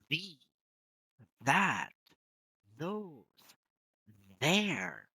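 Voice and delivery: "The, that, those, there" each with a strong voiced th; voiced th words. Shown as a demonstration. Teacher models TH words one by one with emphasis. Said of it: "put emphasis on that".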